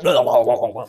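loud chugging babble; mock chug noises; gulping babble. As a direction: gargle it like chugging milk.

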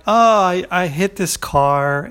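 high, loud, flustered start, then slowing right down; caught off guard. Retold or imitated. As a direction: stammer it out like you've just been caught. Startled driver caught in the act.

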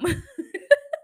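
a short burst of high laughter. Real, not acted. Laughing at her own joke about the dumb man, high burst. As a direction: laugh out loud at yourself.

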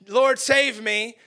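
loud, slow mock plea; crying for help. Drowning Peter crying out to the Lord, loud slow plea. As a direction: call out as if drowning.

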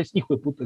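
"hijo de puta" squeezed into "ijueputa"; fused Colombian pronunciation. Shown as a demonstration. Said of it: "aplicamos la economía del lenguaje".

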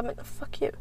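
under the breath whisper; whispered insult at the bartender. Retold or imitated. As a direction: mutter it voicelessly.

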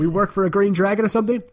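quick, high, clueless henchman; dim bandit. Acted. Gang member asking about the boss, dim bandit voice. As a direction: pitch it way up, dopey and puzzled. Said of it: "low charisma, low intelligence name".

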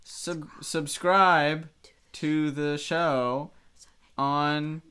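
slow, drawn-out words. Pretend. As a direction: stretch every word out. Jokingly chiming in slowly.

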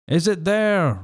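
high roar. A narrator's reading. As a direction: demand it like a blind tyrant. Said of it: "roared Pugh".

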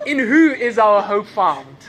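loud, slow preaching question with leftover laughter; big deliberate question to the congregation; loud restart. Real, not acted. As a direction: project it slowly, shaking off the giggles. Resuming sermon after fit of laughter.

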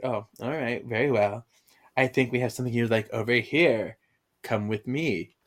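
low, soft, mock-suave French accent; bad French accent. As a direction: usher them along in bad French. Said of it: "the girl doing a bad French accent".

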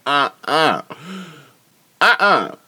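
high, emphatic "uh-uh"; scandalized refusal. Retold or imitated. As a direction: refuse it in mock horror. Appalled refusal at the kiss.

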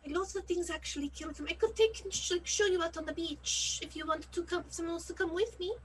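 put-on character voice, well above own pitch; high, earnest pleading with the guards; earnest drummer character, persuading.

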